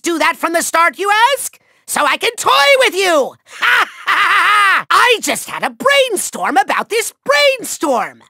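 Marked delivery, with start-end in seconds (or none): 3.5-4.9 drawn-out mocking laugh; evil cackle. Villain toying with his foe, mocking cackle. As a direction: cackle as you toy with them.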